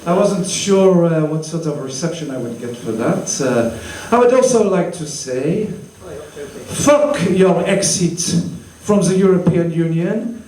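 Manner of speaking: put-on Frenchman speaking English; halting, heavily French-accented English. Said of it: "as you can tell, I'm French".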